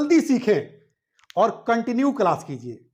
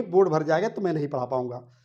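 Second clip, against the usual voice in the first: normal talking voice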